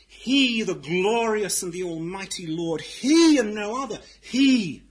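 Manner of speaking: each "he" high, loud and held; stress on "He". Retold or imitated. Pastor putting the emphasis on God, proclaiming each "he" loudly. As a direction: punch every "he" as the one who matters. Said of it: "The emphasis must be on God".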